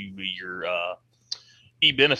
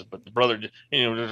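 ordinary talk, nothing marked

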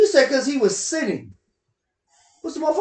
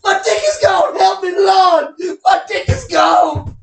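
loud, higher drawn-out frantic yelling; mock panicked yell; 4 semitones higher, 8 dB louder, much slower than usual. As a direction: play the man in pain begging for help. Acted panicked caller yelling.

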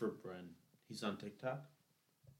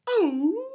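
high, loud, crying oh; a shrill wounded squeal; 13 dB louder than usual. Retold or imitated. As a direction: yelp it like you got hurt. Acting hurt reaction in video.

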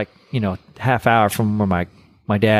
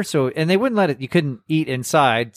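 usual speaking voice

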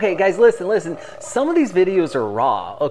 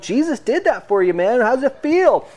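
high, eager and a little louder; excited exclaiming; 9 semitones higher, 5 dB louder than usual. Retold or imitated. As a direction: ask it buzzing with joy. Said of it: "You're like".

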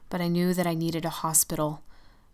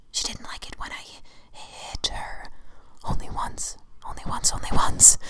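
obsessive whisper. Acted. The shadow confesses the abuse.

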